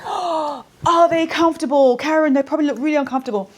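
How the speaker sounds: high, loud startled questioning; shocked friend blurting out; surprised reaction. Retold or imitated. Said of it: "show this is".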